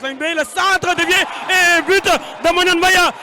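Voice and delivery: loud live match call; excited play-by-play. Real, not acted. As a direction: call the goal with energy. Live goal commentary.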